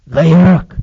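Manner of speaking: a low-pitched word meant for one ear. Retold or imitated. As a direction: say it low but firmly, as if into his ear. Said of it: "بس أقولها لك في ودنك بصوت واطي".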